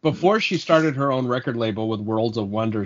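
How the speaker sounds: usual speaking voice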